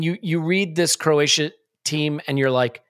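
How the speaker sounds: ordinary talk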